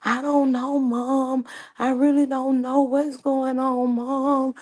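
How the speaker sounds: high mock whiny son; Tim pleading ignorance. Tim telling his mom he knows nothing, high whiny. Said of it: "he was just like".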